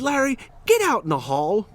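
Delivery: retold high-pitched command; stern order. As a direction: send a pupil out firmly. Teacher orders student out.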